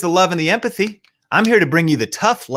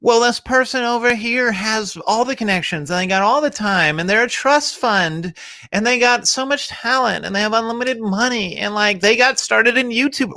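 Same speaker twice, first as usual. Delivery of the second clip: complaining comparer; 6 semitones higher than usual. Retold or imitated. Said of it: "it's about content".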